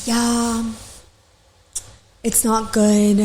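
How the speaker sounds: drawn-out, halting imitation; long pause, then stretch the words; talking really slow. Imitating client who won't be redirected, slow drawl. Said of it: "talking really slow".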